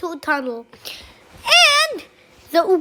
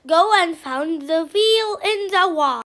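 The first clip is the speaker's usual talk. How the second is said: ordinary delivery, nothing marked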